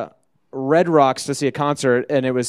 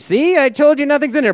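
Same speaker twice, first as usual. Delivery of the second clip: high, loud, overeager innocence; tense protest; 12 semitones higher, 5 dB louder than usual. Retold or imitated. Teenager opening doors for a cop, tensely insistent. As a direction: act out nervous reassurance, voice pushed up. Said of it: "I'm, like, so tense".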